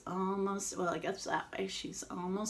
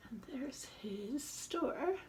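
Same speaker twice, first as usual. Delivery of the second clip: slow hushed whisper; 5 semitones higher, 9 dB quieter, slower than usual. Real, not acted. Aside to kids showing picture.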